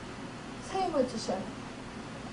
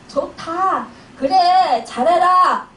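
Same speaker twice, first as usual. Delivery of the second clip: loud, drawn-out mock glee; gloating Satan; 3 semitones higher, 8 dB louder, much slower than usual. Satan delighted that the family is scattering, gloating cheer. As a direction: cheer them on with wicked delight, building louder. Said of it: "사단은 더 신났다".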